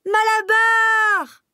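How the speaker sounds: very high, loud, slow anguished shout; voice of catastrophe. Acted. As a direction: scream his name in horror. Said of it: "d'une voix de catastrophe". (Douce crie à Malabar, d'une voix de catastrophe)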